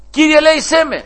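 high, loud cry of Κύριε, ελέησέ με; pleading cry. Acted. Preacher voicing the woman crying after Jesus, loud and high. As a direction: cry out for mercy with all her strength. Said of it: "συνέχιζε να φωνάζει".

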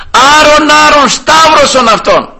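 high, loud, drawn-out mob cry; crowd shouting. Acted. Crowd demands crucifixion from Pilate, shouting. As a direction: hurl out the verdict like a mob. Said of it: "όλους αυτούς εκεί που φωνάζανε".

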